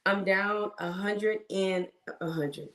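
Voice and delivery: ordinary talk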